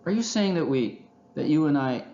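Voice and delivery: high, halting disbelief; taken aback. A narrator's reading. Raccoon questioning his friend's plan, astonished. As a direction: falter in astonishment.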